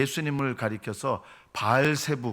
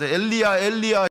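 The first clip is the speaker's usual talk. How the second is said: high, loud twisted cry; mocking mimicry; 9 semitones higher, 7 dB louder than usual. Crowd distorts Jesus' cry from the cross, mocking. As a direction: mimic a misheard call. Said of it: "예수님 말을 막 비틀고".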